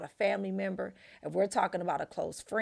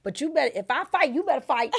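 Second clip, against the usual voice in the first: hard punched demand, louder and higher; you better fight; 5 semitones higher, 6 dB louder, faster than usual. Retold or imitated. Wife telling husband to fight beside her. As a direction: insist he back you up.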